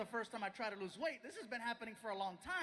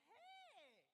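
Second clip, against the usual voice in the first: put-on high, sing-song girlish hey; a bright high greeting from a starstruck girl; excited fan hello; 9 semitones higher, 18 dB quieter than usual. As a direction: greet him like a giddy fan. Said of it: "she goes".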